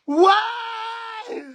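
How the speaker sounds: very high, drawn-out laughing shriek; real piercing squeal; laughing wail. Real, not acted. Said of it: "right now I'm laughing at you".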